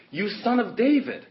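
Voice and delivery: high, loud acted appeal; retold cry for help; imitated crying out. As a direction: call out to him, pitched well up. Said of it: "And she cried to him".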